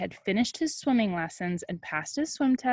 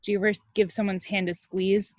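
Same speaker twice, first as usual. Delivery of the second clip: usual speaking voice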